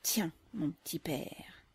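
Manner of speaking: slow, whispered spite; gloating whisper. Acted. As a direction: whisper it with quiet satisfaction. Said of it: "en pensée, avec un sentiment de malveillance satisfaite".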